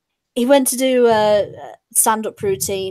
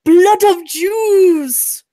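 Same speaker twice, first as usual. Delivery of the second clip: slow, higher, louder shout; shouted punchline; 9 semitones higher, 5 dB louder, much slower than usual. Retold or imitated. Comedian shouting the punchline back at the heckler. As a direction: drag out the punchline loudly. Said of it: "And he's going".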